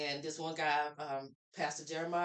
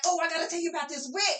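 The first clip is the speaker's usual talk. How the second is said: eager blurt; 11 semitones higher, 5 dB louder, faster than usual. Retold or imitated. Eager to share on tour.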